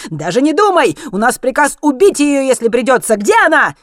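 high, loud, furious demand; shouted threat. A narrator's reading. Zlotan threatens to kill her if necessary, shouting. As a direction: yell the warning and the demand. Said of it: "крикнул Злотан".

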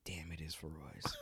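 Feroz whispered as a hushed realization; whispered aside. Retold or imitated. Realizing the name aloud, whispered to self. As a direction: breathe it out, a hushed damn. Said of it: "And I was like".

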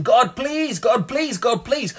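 high, repeated pleading God please; pleading beggar. Retold or imitated. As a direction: mimic a faithless, desperate begging. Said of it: "just coming and begging".

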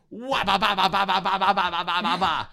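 high, rapid mock zapping "ba ba ba" bursts; playful imitated strobe-light noise; vocal strobe zaps. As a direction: tap out each flash like a machine-gun sound effect. Shows the strobe button sequence.

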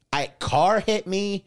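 high, slow, loud incredulity; stunned disbelief. Retold or imitated. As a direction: say it as if reality has stopped making sense. Acting out his stunned disbelief.

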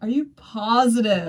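mock realtor. Imitating realtor's doubt.

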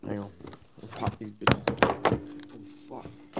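hushed whisper under his breath; whispered aside. Real, not acted. Opening a new bag of Doritos, whispering. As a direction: whisper it to yourself.

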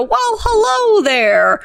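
high, slow, drawn-out hello; silly greeting voice. Retold or imitated. Neighbors greeting each other in a hammed-up high voice. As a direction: stretch out the hello like a goofy gentleman. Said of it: "we have this thing where if we see each other, we go".

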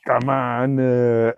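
light mock Austrian accent; hammed-up Austrian accent; Arnold Schwarzenegger. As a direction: put on a drawn-out Austrian accent. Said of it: "do an Arnold Schwarzenegger accent".

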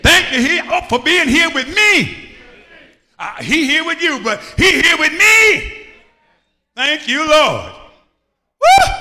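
loud, rising, shouted sermon cadence; full-force preaching. Acted. Pastor rousing the congregation, preaching at full force. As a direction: preach it hard to the gathered church.

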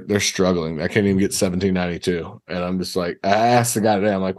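ordinary talk

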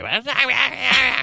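high, loud wordless mock yelling; imitated screaming rant in a high voice. Imitating Patton yelling at everybody, high mock screaming. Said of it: "screaming and yelling at everybody".

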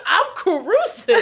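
slow, giggly mock-breezy delivery; carefree cruising. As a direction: stretch out "cruising" through a laugh. Jokes about own carefree driving.

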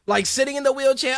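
usual speaking voice

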